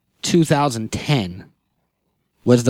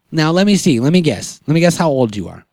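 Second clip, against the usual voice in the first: ordinary delivery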